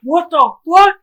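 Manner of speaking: high, loud shocked yelp; replaying his shocked reaction; shocked yell. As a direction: shriek at the screen in disbelief. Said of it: "this is what I was like".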